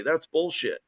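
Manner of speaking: usual speaking voice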